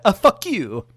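mock-loud curse. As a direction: blurt it out loudly. Comic sign-off curse.

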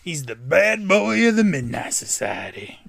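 hammed-up swaggering tribute; mock-grand bad-boy introduction; mock hype. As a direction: stretch it out like a playful hype man.

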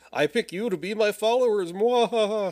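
hammy villain gloat with evil laugh; sneering panto villain. Retold or imitated. Mocking Pinhead picking followers.

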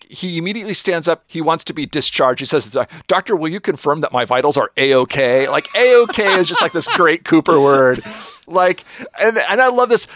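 bubbling over with delight; excited retelling. Real, not acted. Podcaster excitedly recounting Cooper's hospital exit, high and eager.

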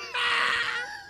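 very high hammed-up sob; high crying wail. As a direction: wail like you're being hauled away. Acting out the arrested sailor.

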